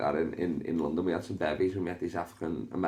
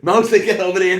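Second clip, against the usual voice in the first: high, loud call across the room; 12 semitones higher, 13 dB louder than usual. Retold or imitated. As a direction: yell to summon a mate from over there. Retold calling friend over.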